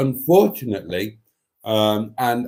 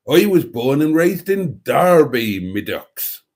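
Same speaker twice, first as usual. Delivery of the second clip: higher, louder put-on Derby accent, dropping off on "me ducks"; 4 semitones higher, 5 dB louder than usual. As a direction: do your best hammed-up Derby local. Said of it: "otherwise it's not real Derby".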